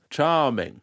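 imitating Bond. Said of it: "all he seems to say is just".

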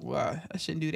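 low, soft retreat; abashed self-talk. Retold or imitated. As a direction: back off quietly, catching yourself. Quotes self catching the habit.

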